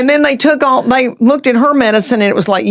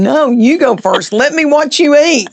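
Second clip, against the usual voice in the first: playful insisting; 5 semitones higher than usual. Retold or imitated. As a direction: insist on it, rising in pitch towards the end. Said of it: "I'm going".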